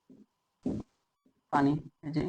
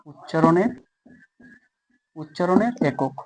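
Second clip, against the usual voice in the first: dictation while writing; 5 dB quieter than usual. Shown as a demonstration. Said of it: "আমি একটু লিখে দিই".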